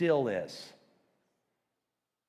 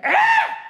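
a sudden scream, much higher and louder; piercing scream; 11 dB louder than usual. Shown as a demonstration. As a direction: scream it out to grab attention. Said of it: "I wanted to illustrate".

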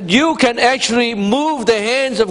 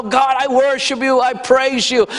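loud praise; 5 semitones higher than usual. Retold or imitated. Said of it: "be as loud".

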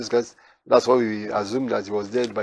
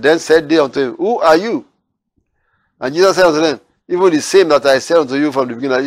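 higher, louder proclaiming of the verses; preaching at full force; 4 semitones higher, 5 dB louder than usual. Acted. Preacher proclaiming Jesus' answer to the Pharisees, forceful. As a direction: lift the words of Jesus over the church. Reading scripture.

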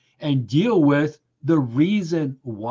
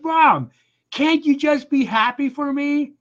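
much higher, whiny pleading to mom; exasperated plea; 10 semitones higher than usual. Retold or imitated. Son pleading with his mom over dinner, high and exasperated. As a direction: beg her to just be glad, exasperated. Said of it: "And I'm like".

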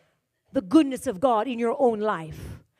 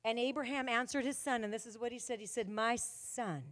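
lower, softer, slow telling of Abraham and Isaac; soft scripture storytelling; 4 semitones lower, 5 dB quieter, slower than usual. Acted. Preacher telling the story of Abraham and Isaac, softer. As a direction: draw the listeners in gently.

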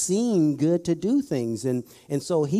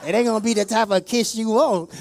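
comic threat; 7 semitones higher, 5 dB louder than usual. Acted.

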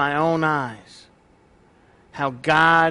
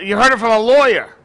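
louder, quicker playful dig at the lawyer; teasing jab; 4 semitones higher, 6 dB louder, faster than usual. Acted. Pastor teasing the lawyer in the congregation, playfully louder. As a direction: poke fun at him with a grin. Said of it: "Just kidding".

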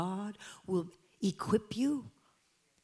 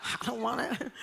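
laughing; 4 dB quieter than usual. Pretend. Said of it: "It's like".

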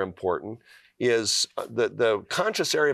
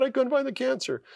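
much higher, echoing the doctor's words in wonder; much higher amazed repeat of the doctor's words. Retold or imitated. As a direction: lift it way up, stunned it's coming true.

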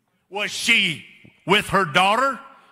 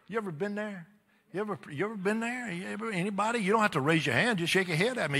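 much softer, leaning in to the crowd; soft confiding question; 10 dB quieter, faster than usual. Real, not acted. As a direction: ask gently, almost private. Preacher asks congregation softly.

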